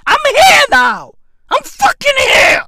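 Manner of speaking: high loud furious shout; real fed-up yell; angry shout. Real, not acted. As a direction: yell it at the top of your voice. Angry rant peak.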